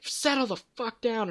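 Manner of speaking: high, held-back mock snap; Ben. Retelling Ben finally snapping at Kenny, mock outburst. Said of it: "Ben snaps".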